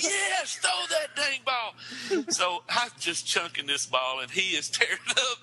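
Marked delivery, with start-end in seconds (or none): 0.0-2.2 excited shout. Retold or imitated. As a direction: cheer him on gleefully. Shouts encouragement in the store.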